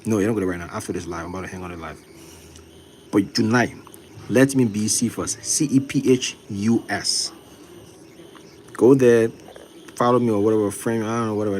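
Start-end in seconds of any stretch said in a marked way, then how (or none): none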